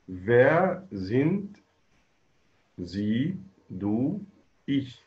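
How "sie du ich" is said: normal talking voice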